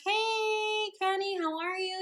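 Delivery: high sing-song hello; high-pitched "hey, you" voice; high greeting. Retold or imitated. Acting out her greeting to him.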